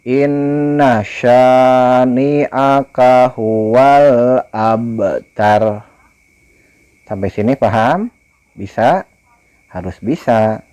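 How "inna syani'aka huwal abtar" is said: slow, melodic chant with long vowels; Quran recitation. Shown as a demonstration. Teacher models the verse for pupils, slow chant. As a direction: recite slowly, stretching each vowel. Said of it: "Syanya sya gede ya".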